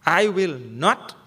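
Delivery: slow, deliberate I will not; flat-out refusal, slowly; firm refusal. Shown as a demonstration. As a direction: plant each word like a wall. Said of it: "Like that".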